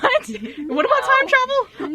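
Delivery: high, drawn-out surprised question; incredulous. Acted. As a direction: stretch it out in mock disbelief.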